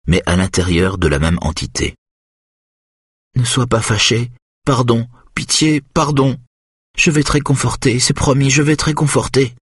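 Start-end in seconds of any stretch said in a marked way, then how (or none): 3.4-9.5 put-on high childlike voice, begging forgiveness; little girl pleading. Hypnotized Charlotte begs the angry one for pardon, childlike and pleading.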